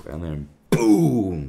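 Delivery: loud, slow drawn-out boom; explosion sound. Retold or imitated. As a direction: blow it up with your voice. Mimicking an explosion.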